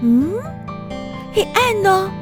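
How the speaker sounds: normal talking voice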